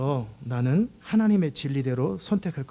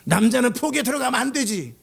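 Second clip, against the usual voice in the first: higher, insistent quoted rule; mock scolding rule; 6 semitones higher, 3 dB louder than usual. Preacher voicing an ingrained belief about men and kitchens, mock scolding. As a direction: lay down the rule, mock indignant. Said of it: "잘못된 프로그램이 있어요".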